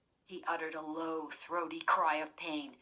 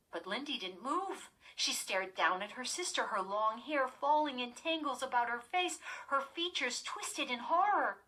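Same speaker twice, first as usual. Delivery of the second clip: usual speaking voice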